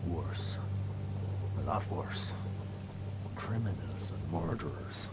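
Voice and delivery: low, hushed whisper; fearful whisper. Acted. Frightened man whispering secrets about the Carpenters to the hero. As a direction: whisper it so nobody overhears.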